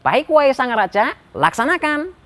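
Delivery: high-pitched minister accepting orders; reply obediently in a high voice. Acted. Minister obeys the king.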